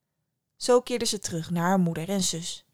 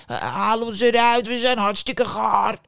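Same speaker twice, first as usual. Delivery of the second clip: calling loaves; 5 dB louder than usual. Acted. Loaves call from the oven.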